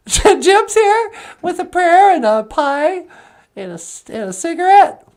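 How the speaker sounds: very high, bright greeting; a cheerful high puppet hello; [name]'s puppet voice. Acted. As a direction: pitch way up for the puppet.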